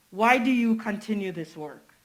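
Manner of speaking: normal talking voice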